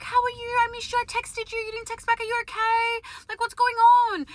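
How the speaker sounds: put-on high, sing-song baby talk. Checking in on the cafe lady, high babying voice. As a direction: coo at her in a high voice like you're babying her. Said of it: "That is how I talk, like a fucking baby to her".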